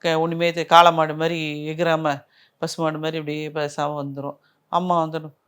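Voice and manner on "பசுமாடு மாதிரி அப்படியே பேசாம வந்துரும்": ordinary talk, nothing marked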